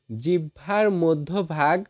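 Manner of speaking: ordinary talk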